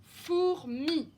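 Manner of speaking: each syllable of "Fourmi" punched separately. Shown as a demonstration. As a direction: sound out "Fourmi" in two strong beats. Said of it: "J'entends le son I dans la deuxième syllabe".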